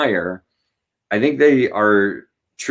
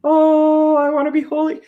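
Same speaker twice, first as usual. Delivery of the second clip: high, far, mock-holy pious kid; mock a saintly little kid. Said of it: "a kid in church is gonna be like".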